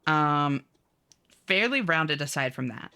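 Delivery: ordinary talk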